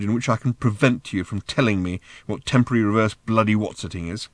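plain reading voice

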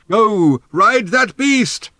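slow, high, drawn-out yell; loud long-distance holler; shouting. A narrator's reading. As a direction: urge them on from far away. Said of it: "shouted Thrashbog".

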